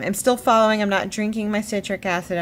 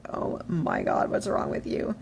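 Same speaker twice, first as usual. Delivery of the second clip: put-on exasperated boyfriend; 5 dB quieter than usual. Boyfriend to whining girlfriend, imitated quiet exasperation. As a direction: imitate a weary boyfriend under your breath.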